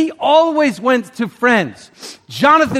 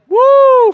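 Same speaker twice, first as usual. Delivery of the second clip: high loud whoop of release; joyful whoop; 10 dB louder than usual. Retold or imitated. Preacher recalling his freedom to the congregation, loud joyful whoop. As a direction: let out a ringing woo of relief.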